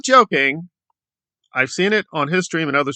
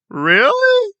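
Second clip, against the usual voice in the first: much higher, falling "Really?"; stunned disbelief; 4 dB louder than usual. Real, not acted. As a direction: push it higher in utter disbelief. Incredulous reaction.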